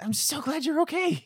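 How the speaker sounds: put-on like a tiny boy clinging to a leg; high little-kid gladness; tiny creature voice. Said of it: "He said".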